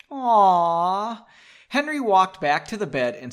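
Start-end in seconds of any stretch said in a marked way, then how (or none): 0.1-1.2 long, higher, loud "aww"; drawn-out sympathetic coo. Real, not acted. Reader reacts to the couple's tenderness, cooing.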